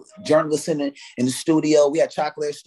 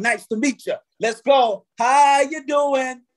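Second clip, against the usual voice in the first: hammed-up greeter; 9 semitones higher, slower than usual. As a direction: play a fake-friendly handshake. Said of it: "here we come".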